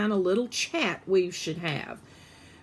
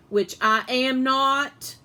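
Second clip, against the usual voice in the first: slow, louder, firm; emphatic denial; 5 semitones higher, 5 dB louder, slower than usual. A narrator's reading. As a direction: insist on it.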